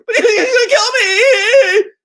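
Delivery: high, loud, wailing mock hysteria; sister's manic talk. Showing how sister accuses him, manic wailing. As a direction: wail it like a panicked sister, voice way up. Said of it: "That's how she talks".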